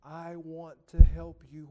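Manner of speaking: loud, slow offer of help; pleading offer. Retold or imitated. Holy Spirit offering help, slow loud plea. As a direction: stretch it out, imploring. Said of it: "The Holy Spirit is like saying".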